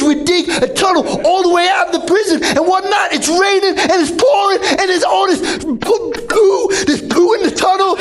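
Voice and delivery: excited yelling. Acted. Telling the escape through the tunnel, high excited yell.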